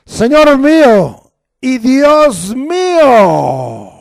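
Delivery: loud, high, slow cry of faith; exclaimed. Retold or imitated. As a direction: proclaim it like an exclamation. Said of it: "está entre signos de admiración".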